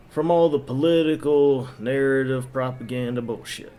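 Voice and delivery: ordinary delivery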